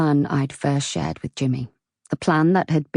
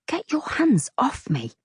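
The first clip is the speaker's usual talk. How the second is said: sharp and much higher, pulling free; indignant protest; 9 semitones higher than usual. Acted. Loretta protesting as Jimmy grabs her, sharp. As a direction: shake him off indignantly.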